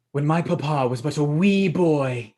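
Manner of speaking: put-on slow, drawn-out old-tale storytelling voice; storyteller with gusto. Storyteller adds pizzazz to a family tale, hammed-up gusto. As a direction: ham up the storytelling with extra oomph. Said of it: "Why are you talking like that?".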